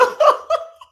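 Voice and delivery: sharp high burst of laughter; high cackling laugh. Real, not acted. Laughing at a viewer comment, high cackle. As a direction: crack up at the comment.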